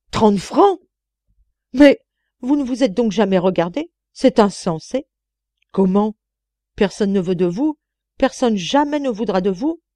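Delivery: loud, high indignant outburst; furious scolding old woman; outraged. A narrator's reading. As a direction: blast her for daring to ask. Old lady outraged at the wage.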